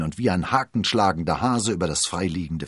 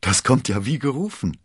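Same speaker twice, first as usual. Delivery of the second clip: delighted, higher and louder; beaming; 7 semitones higher, 5 dB louder than usual. A narrator's reading. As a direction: exclaim it with open delight. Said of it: "strahlte Athos". (freudig strahlend, laut)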